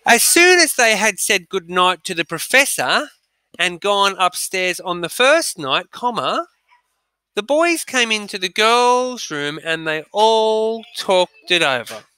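slow, deliberate read-aloud for dictation. Shown as a demonstration. Teacher dictating to pupils, slow and clear. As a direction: read each phrase slowly so they can write. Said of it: "I'm gonna read it out twice".

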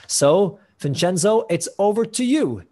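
bright, lifted host voice handing over; upbeat handover. Real, not acted. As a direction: hand the floor over with energy. Host handing over to next speaker.

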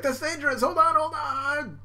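very high, alarmed pleading; hammed-up cartoon fright; mock panic. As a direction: plead like a minion about to crash. Victim panics.

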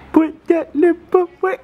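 very high, slow, separate words; playful order at the top of the voice; squeaky baby command. Pretend. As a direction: squeak each word out one by one. Fourth take, highest.

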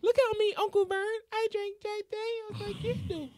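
tiny high-pitched girly mockery; cutesy niece voice. Host plays niece showing off to uncle, cutesy squeal. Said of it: "your niece drink it. 'Cause it's cute".

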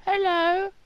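put-on high squeaky little kid voice; child voice. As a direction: play a tiny child on the phone. Voicing the child Simon Junior.

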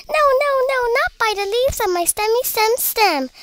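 high, squeaky refusal; squeaking. A narrator's reading. First pea shooter refusing the imp, squeaking. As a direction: squeak it out, starting loud. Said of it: "squeaked the third little pea shooter".

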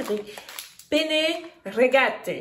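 slow, careful Italian accent. Shown as a demonstration. Showing her viewers her Italian accent on penne rigate. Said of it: "Comment est mon accent?".

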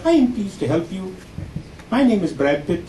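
put-on high tech-support Indian accent; call-centre agent with an Indian accent. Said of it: "I'm sure you've heard this voice before".

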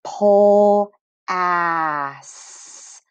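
"Pole ass" drawn out very slowly, syllable by syllable; slow phonics stretch of "Pole ass"; slow sounding out. Shown as a demonstration. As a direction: stretch the first syllable of "plastic" like a teacher. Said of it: "making it an a sound".

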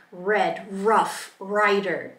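"red, rough, rider" with drawn-out American R. Shown as a demonstration. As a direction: lean on the untrilled R in "rough". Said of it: "Our tongue is already kind of in that position".

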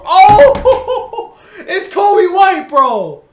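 loud, high yell breaking into laughter; disbelieving shout. Real, not acted. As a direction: howl it, laughing in despair. Screaming laugh at dreaded card.

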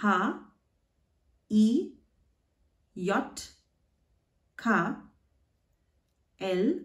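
each letter name alone, long pauses, rising end; German alphabet, letters from A to Z pronounced the German way. Shown as a demonstration. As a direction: say "A, B, C" in German: ah, beh, tseh. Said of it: "let's learn the pronunciation of the German letters".

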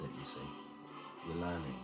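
ordinary talk

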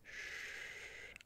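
long, very soft "shh"; quiet, comforting shushing; soothing shush. Acted. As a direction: hush them gently with a drawn-out breath. Soothing a distressed mate.

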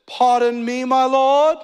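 high, put-on accent for a timid Gideon. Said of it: "that's the accent that I give Gideon".

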